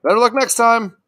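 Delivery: loud, high mock send-off; hammed-up dad voice; deer dad. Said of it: "And his dad's like".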